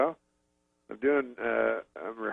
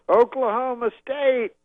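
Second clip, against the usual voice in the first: high, loud stage call, drawn out; Bill Cosby; 11 semitones higher, 6 dB louder, slower than usual. Retold or imitated. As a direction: imitate a comedian rousing a huge crowd. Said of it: "he goes on stage and he goes".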